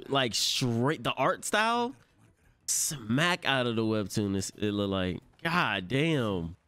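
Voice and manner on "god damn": ordinary talk, nothing marked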